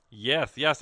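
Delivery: normal talking voice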